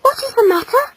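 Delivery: put-on high, small boy's question; puzzled, pleading son; worried child. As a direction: ask anxiously in a high little voice. Anxious child asks parents.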